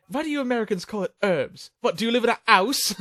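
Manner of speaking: hammed-up British indignation, dropping the H in "house"; mock British questioner. Quoting the guy who challenged Americans, mock British accent. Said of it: "the guy brought it up. He goes".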